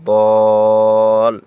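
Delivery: দল with the vowel held long and loud; drawn-out Quranic vowel in দল; four-count madd. Shown as a demonstration. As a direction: stretch দল for four alif counts. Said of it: "চার আলিফ টানি পড়ব".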